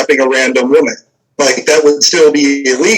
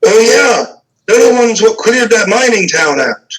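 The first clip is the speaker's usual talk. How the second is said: higher call back across the street; distant guard shouting back; 7 semitones higher than usual. Acted. Guard shouts back to the other guard about the party, higher. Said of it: "the guy shouts back".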